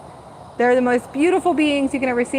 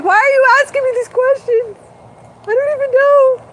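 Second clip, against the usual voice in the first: high, loud tearful whimper; imitating her younger self in tearful despair; retold crying; 13 semitones higher, 6 dB louder than usual. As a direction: whine like a lost, weeping child. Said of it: "I'm sitting there crying".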